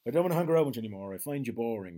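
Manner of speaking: blunt dismissal. Retold or imitated. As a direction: rattle it off like you're done with him. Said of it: "goes to Colin Farrell".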